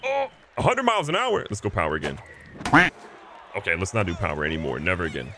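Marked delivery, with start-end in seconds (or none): 0.0-1.4 amazed. Real, not acted. Reacting to fast pitch.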